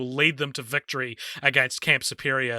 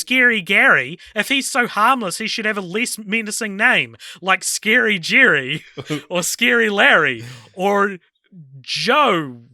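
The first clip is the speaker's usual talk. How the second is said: higher, louder, baffled protest; a kid objecting with mock outrage; squeaky indignant kid rattling off names; Fred's incredulous delivery; 5 semitones higher, 4 dB louder than usual. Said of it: "that's how it's delivered".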